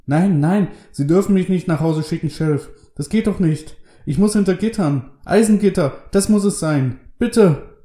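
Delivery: high, loud, panicked begging; frantic high-pitched outburst; desperate pleading. A narrator's reading. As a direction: beg the sheriff frantically. Lester begs to be jailed.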